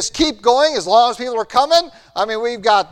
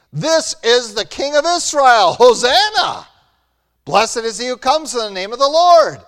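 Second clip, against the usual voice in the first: loud, higher festive acclaim; acting the cheering crowd; 4 semitones higher, 5 dB louder than usual. Crowd hailing Jesus as king, loud festive cheer. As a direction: shout it out like the welcoming crowd.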